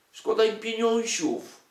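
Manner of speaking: high, loud mocking whine over money; mock pity. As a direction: draw it out, mocking their stinginess. Mocks publishers' stinginess with baby word.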